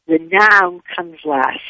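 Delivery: ordinary delivery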